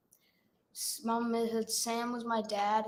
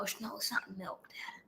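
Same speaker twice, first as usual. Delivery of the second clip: hushed whisper to dad; whispered protest; 2 semitones lower, 11 dB quieter than usual. Real, not acted. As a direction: whisper it so the stream barely hears. Child hushes dad's teasing.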